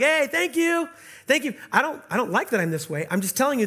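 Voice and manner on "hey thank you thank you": cheery, higher and louder call of thanks; imagined friend thanking. Pretend. Friend honking and waving at the giver, a cheery shouted thank you. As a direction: wave and shout thanks across the street. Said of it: "honking at me and waving like".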